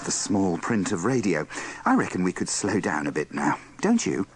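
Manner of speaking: normal talking voice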